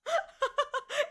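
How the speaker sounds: squealing burst of laughter; high-pitched laugh. Real, not acted. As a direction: shriek with laughter.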